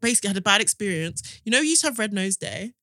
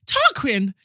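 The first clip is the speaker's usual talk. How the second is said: posh mum; 7 semitones higher, 4 dB louder than usual. Retold or imitated. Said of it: "their mum would have been like".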